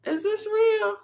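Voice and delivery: high, disbelieving question; surprised. Real, not acted. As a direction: ask in shocked disbelief, high. Shocked at the chart.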